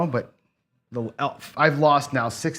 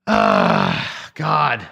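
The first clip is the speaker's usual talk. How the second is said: frustrated groan; 7 dB louder than usual. Real, not acted. Losing roll at craps.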